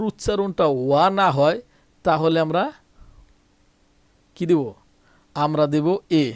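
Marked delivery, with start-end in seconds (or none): none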